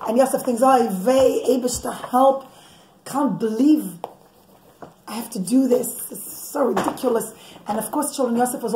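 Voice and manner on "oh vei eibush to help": ordinary delivery, nothing marked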